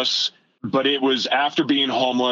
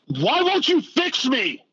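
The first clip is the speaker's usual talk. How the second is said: angry plea; 14 semitones higher than usual. Retold or imitated. Yelling at God alone in a jail cell, furious plea. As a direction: demand an answer from God, raw and desperate. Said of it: "And then I got mad".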